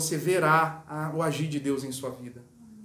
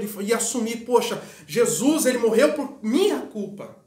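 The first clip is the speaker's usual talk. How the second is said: fervent preaching; 4 semitones higher than usual. Acted. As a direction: preach it out over the congregation. Preacher rising to full force. (pregação fervorosa, mais alta e mais forte)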